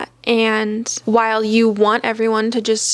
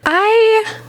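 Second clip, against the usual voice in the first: surprised; 12 semitones higher, 5 dB louder than usual. Real, not acted. Stunned reaction to a review.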